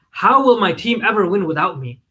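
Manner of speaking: ordinary delivery, nothing marked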